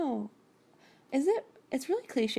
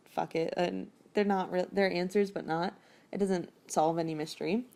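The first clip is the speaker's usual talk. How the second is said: usual speaking voice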